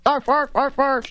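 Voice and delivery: high yapping dog bark; yappy little dog. Pretend. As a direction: yip like a tiny Chihuahua. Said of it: "that little dog has Hillary's dog bark".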